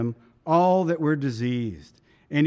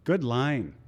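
normal talking voice

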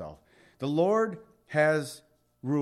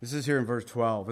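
usual speaking voice

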